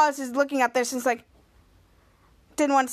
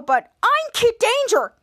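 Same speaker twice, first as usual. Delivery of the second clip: high, drawn-out mock objection; indignant protest; 9 semitones higher, 3 dB louder, slower than usual. Henry insists the hero job is his, indignant. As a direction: stretch it out, pitched well up. Retold protest over being replaced.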